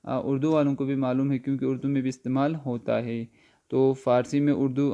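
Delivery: ordinary delivery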